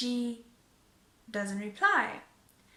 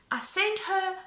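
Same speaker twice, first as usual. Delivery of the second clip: usual speaking voice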